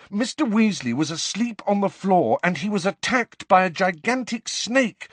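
high, agitated, building in urgency; angry insistence. A narrator's reading. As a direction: plead frantically to be taken seriously. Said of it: "said Harry angrily".

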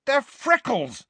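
high, loud furious retort. A narrator's reading. Boy furiously telling a portrait to go away. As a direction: snap back in fury. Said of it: "said Ron furiously".

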